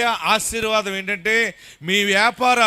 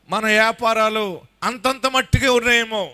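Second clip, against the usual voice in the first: usual speaking voice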